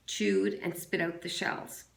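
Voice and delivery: ordinary delivery, nothing marked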